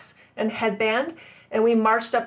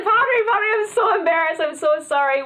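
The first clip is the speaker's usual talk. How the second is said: much higher, pleading, almost in tears; near tears; 5 semitones higher than usual. Retold or imitated. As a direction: beg the priest, embarrassed and close to crying. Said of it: "I was practically in tears".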